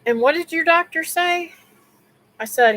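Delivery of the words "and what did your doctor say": pitched-up doctor asking, lightly voiced; lightly voiced doctor, high and curious; doctor's question. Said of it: "And he says".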